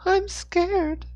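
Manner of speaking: put-on very high, thin-pitched child voice; scared little boy. A narrator's reading. Boy confessing fear to a stranger, scared little boy. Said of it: "he whispered".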